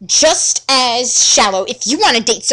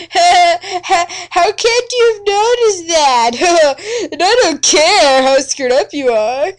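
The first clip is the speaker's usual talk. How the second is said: high, laughing and sobbing through the compliments; gushing giggly boy; 4 semitones higher than usual. Acted. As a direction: overcome with adoration, giggling. Mike gushing over Tatiana.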